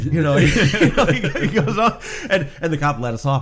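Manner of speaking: loud, high, laughing through the words; can't stop laughing while telling it. Real, not acted. Telling friends the punchline, loud and laughing.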